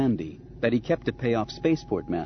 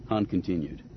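ordinary delivery

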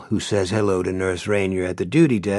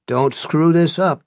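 warning; 7 semitones higher, 7 dB louder than usual. A narrator's reading. Z-Boy warns.

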